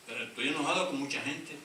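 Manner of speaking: ordinary delivery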